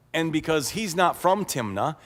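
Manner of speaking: plain reading voice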